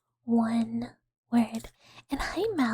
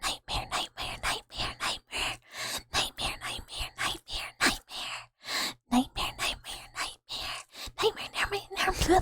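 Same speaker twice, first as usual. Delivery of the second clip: repeated whisper; 7 semitones higher, 3 dB quieter than usual. Shown as a demonstration. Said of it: "they repeat each other".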